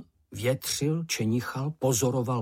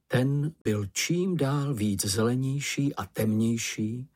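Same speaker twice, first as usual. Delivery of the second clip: ordinary delivery, nothing marked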